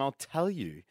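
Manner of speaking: ordinary talk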